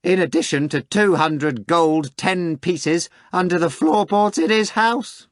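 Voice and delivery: a gleeful crackling tattletale; high cracked voice spilling his secret. Acted. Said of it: "came a cracked voice".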